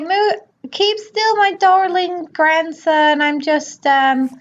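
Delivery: put-on high, sweet old grandmother; high doting granny voice, slowed on the endearment; grandma voice. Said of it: "she's like".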